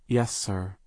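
usual speaking voice

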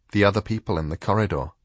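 plain reading voice

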